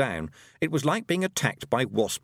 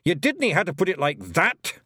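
snapped; 7 semitones higher, 3 dB louder than usual. A narrator's reading. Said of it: "he snapped".

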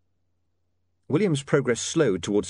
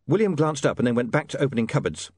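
usual speaking voice

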